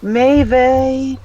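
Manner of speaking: high, loud sing-song maybe; high teasing maybe. Acted. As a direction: tease it up high. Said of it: "Maybe, maybe, maybe".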